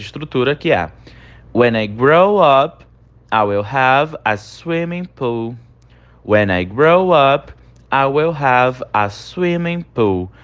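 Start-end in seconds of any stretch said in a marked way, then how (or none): 1.5-5.5 slow model reading. Shown as a demonstration. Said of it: "Quando eu crescer". 6.3-10.3 slow model reading. Shown as a demonstration. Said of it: "Quando eu crescer".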